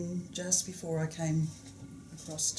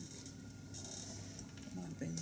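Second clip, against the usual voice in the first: whispered; 15 dB quieter, much slower than usual. Real, not acted. Thinking aloud to herself.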